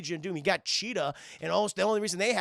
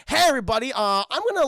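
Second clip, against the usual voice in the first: Kite Man's cheery hello; 6 semitones higher, 9 dB louder, faster than usual. Retold or imitated. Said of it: "he goes".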